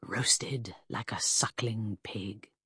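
slow, low gloating whisper; relished whisper. A narrator's reading. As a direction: savour each word under the breath. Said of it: "imagined with relish".

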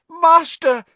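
high, loud desperate shriek. A narrator's reading. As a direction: shriek out in terror to your master. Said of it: "he shrieked".

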